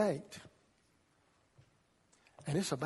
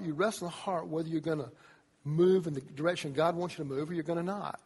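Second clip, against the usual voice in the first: ordinary talk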